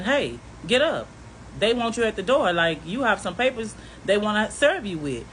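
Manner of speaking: furious, shouting him out of bed; angry yelling. Retold or imitated. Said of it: "So now I'm pissed".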